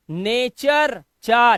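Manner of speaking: नेचर said slowly with चा stretched out; slow pronunciation drill. Shown as a demonstration. Teacher drilling students on the चा sound slowly. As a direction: draw out नेचर and land on चा. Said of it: "अब देखो यहां पर".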